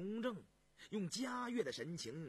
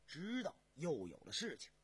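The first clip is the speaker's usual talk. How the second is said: usual speaking voice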